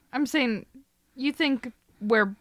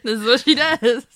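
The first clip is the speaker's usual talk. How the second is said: higher, louder, through laughter; laughing mock quote; 4 semitones higher, 5 dB louder than usual. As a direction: tease by quoting it back, laughing. Laughing while quoting co-host back.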